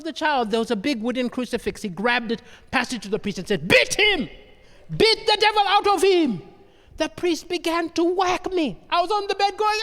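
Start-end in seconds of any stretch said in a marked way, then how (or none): 3.7-6.4 raised, high acted order; frenzied command. Maniac dad urging the priest on, frenzied and high.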